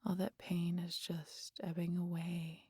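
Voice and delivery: soft whispered lulling; soft hypnotic whisper to the hunter. Acted. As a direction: coax him gently under. Deepens the hypnotic trance.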